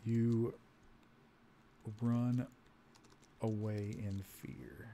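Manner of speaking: very soft, slow, word by word; slow dictation. Real, not acted. Player typing an escape from the charging horde, slow soft dictation. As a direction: think aloud while typing, with long gaps. Speaking slowly while typing input.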